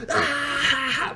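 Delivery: high forced jeering laugh; mock scream laughing. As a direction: imitate scream-laughing in someone's face. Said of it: "just scream laughing at this fucking dude's face".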